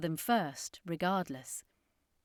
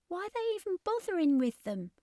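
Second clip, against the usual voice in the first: put-on much higher, puzzled girl; Ashley asking; 11 semitones higher than usual. A narrator's reading. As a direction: ask it in disbelief, high up. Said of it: "Ashley asked".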